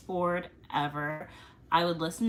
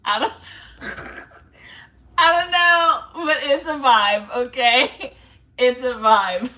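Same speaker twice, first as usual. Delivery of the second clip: laughing through the words, louder and higher; 4 semitones higher, 9 dB louder than usual. Real, not acted. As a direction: giggle it out, a bit embarrassed. Laughing at own confession.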